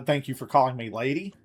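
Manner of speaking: ordinary talk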